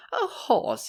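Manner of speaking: high, incredulous mockery; scornful jeer. A narrator's reading. Horace scoffing at Will's pony, jeering. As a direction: scoff at the very idea. Horace jeers after braying laugh.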